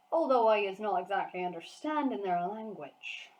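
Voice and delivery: lightly voiced boastful giant; proud, folksy giant talk; BFG giant voice. A narrator's reading. Giant telling Sophie about his ears, lightly voiced giant.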